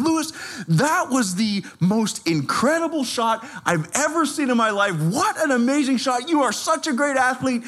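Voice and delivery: imitating a thrilled coach, high-pitched; high, excited retold praise. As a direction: gush praise as the elated coach. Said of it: "he was so excited".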